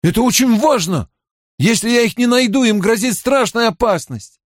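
high-pitched, pressing warning; urgent insistence. Acted. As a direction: make her grasp the danger. Mat pleads that friends are endangered.

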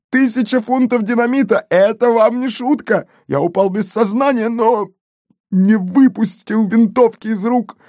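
very high, agitated gendarme; overwrought report. A narrator's reading. Gendarme telling his chief of the dynamite blast, overwrought. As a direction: pile up the catastrophe in a high, frantic voice. Gendarme dramatizes the jail explosion.

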